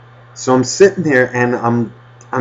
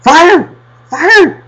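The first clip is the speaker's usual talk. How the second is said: very high, loud panicked shriek of fire; shrieking like a little girl; 8 dB louder than usual. Retold or imitated. Altar boy with a burning robe shrieks fire at church. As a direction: screech it out like a frightened little girl. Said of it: "he turned around like a little girl".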